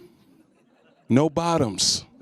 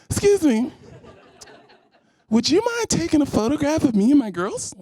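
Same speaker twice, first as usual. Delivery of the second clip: high polite mock-girly voice, much higher; mock sweet woman asking; 8 semitones higher than usual. Woman politely asking him for a photo, high sweet voice. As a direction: sweetly ask a favour in a high voice. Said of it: "I'm gonna ask him".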